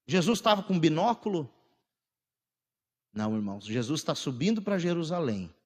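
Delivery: ordinary talk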